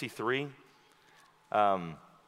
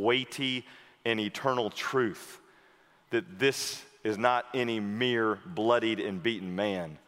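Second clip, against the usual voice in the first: ordinary delivery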